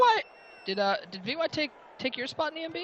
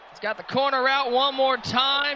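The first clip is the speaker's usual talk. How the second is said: higher, sustained excited call; live play-by-play; 6 semitones higher than usual. Real, not acted. Announcer calling a sideline catch to viewers, excited play-by-play. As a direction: call the catch with rising suspense.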